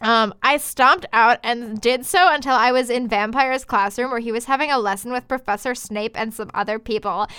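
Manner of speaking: usual speaking voice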